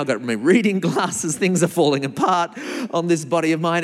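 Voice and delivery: usual speaking voice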